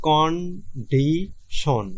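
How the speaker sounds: very slow, syllable-by-syllable condition, con-di-tion; split into syllables. Shown as a demonstration. Teacher showing students three syllables, very slow split. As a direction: stretch condition out into three separate beats. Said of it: "এই শব্দটা উচ্চারণ হইতেছে, তিনভাবে".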